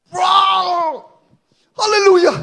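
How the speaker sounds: high, loud crying praise; joyful cry. Real, not acted. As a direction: shout overcome with joy. Overjoyed praise.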